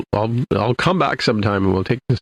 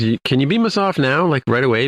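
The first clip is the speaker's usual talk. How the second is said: urgent request; 6 semitones higher than usual. Retold or imitated. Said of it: "so Pike says".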